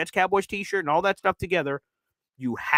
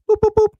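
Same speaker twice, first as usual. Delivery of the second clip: mock whistle; 9 dB louder than usual. Signalling a timeout with a whistle.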